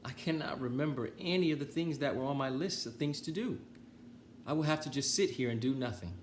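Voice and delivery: high, mournful Toad voice; gloomy Toad. Acted. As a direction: lament it, defeated.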